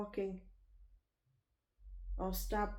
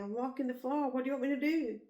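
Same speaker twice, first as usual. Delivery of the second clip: higher and louder, slowing down; desperate protest; 5 semitones higher, 4 dB louder, faster than usual. Retold or imitated. To the husband who won't help, higher, louder desperate plea. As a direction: plead that you're doing everything. Pleading with husband over baby.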